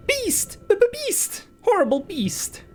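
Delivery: very high, squeaky stammering cries; mock panicked cry. Streamer mimicking a frightened character near a beast, squealed mock panic. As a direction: squeal it like a tiny terrified character.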